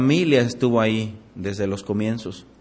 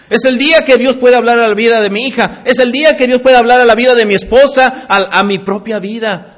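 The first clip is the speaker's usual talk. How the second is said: full-force preaching; 8 semitones higher, 9 dB louder than usual. Acted. Pastor warning his church, preaching hard. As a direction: hammer the warning home to the church.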